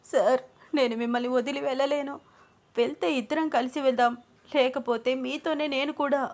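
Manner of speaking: high, weepy pleading; narrator's acted crying voice; tearful plea. A narrator's reading. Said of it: "అని ఏడుస్తూ అంది అను".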